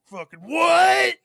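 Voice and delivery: high, loud, drawn-out yell; baffled yell. Retold or imitated. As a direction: shout it slowly in disbelief. Imitating himself baffled.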